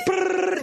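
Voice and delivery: high trilled "brrr"; imitated rolling noise. Comic describing a skater tumbling fast, imitated high rolling trill. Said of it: "he would go like".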